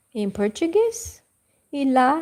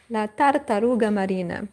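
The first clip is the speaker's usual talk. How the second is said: La tartaruga marina said fast; quick repeat; faster than usual. Shown as a demonstration. Teacher repeating the Italian phrase quickly. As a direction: rush through La tartaruga marina. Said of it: "And in Italian".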